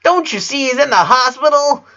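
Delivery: put-on high, loud little girl voice, indignant; a child sticking up for her brother; Lena, a little angry. A narrator's reading. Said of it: "looking at her a little angry".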